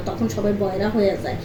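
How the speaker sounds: usual speaking voice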